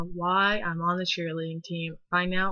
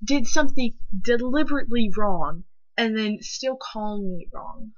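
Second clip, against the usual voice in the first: ranting at someone who won't apologize; indignant; 3 semitones higher, 5 dB louder than usual. Real, not acted. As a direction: spell out the wrong word by word. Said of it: "angry rant".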